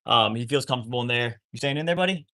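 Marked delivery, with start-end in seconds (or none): 1.5-2.3 pet voice. Real, not acted. Talking to dog in kennel.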